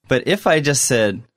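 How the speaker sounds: usual speaking voice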